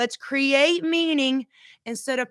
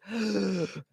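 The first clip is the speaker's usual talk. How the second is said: imitated sucking gasp for air; labored gasp; 3 semitones lower, 7 dB quieter than usual. Coach imitating a hospital patient on a breathing machine, labored gasp. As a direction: suck air in like a failing vacuum. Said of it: "they're just sucking in like a".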